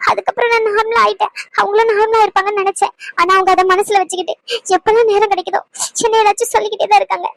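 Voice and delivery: helpless, weepy complaint with catching breaths; crying. Acted. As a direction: pour out the hurt through tears. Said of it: "சரி, அழுகாதீங்க".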